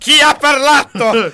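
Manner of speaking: high, loud, drawn-out cry of fake fright; mock alarm. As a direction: call out like a hammed-up ghost-story victim. Mock fright at ghostly voices.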